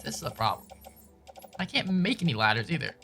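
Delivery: ordinary talk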